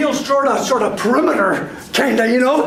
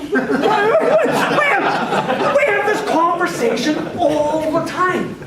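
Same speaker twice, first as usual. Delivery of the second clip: usual speaking voice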